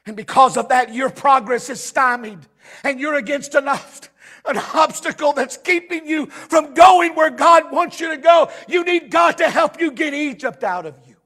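driving pulpit delivery; impassioned preaching. Real, not acted. Preaches at full force.

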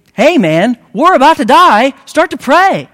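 frantic sailor shout at sleeping Jonah; panicked shout. Retold or imitated. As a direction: wake him in alarm, full yell. Pagan sailor wakes Jonah.

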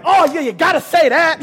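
high, loud, eager giving in; eager yes. Retold or imitated. Pastor caving to the devil's biscuits, high eager yes. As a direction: jump at the offer with glee. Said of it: "I'd be like".

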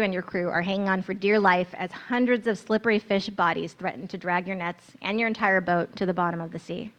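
usual speaking voice

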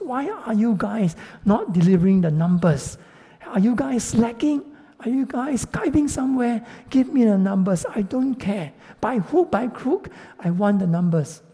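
mock-angry manager demanding results; scolding boss. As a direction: berate the team for slacking. Boss scolding staff over numbers.

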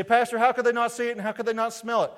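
high, loud, incredulous question; questioning congregant. Acted. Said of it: "You say".

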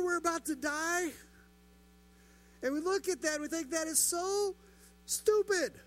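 high, loud exasperated disbelief; mock exasperation. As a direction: throw up your hands at the disciples. Preacher mocks panicking disciples.

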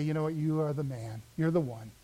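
soft, low closing words; very quiet. Shown as a demonstration. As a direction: say it gently and low, like a verdict. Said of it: "very quietly then ... just say".

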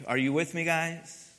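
ordinary talk

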